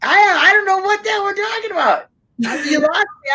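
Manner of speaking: high, bewildered exclamation; confused. Real, not acted. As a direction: sound totally lost and baffled. Confused after missing the chat.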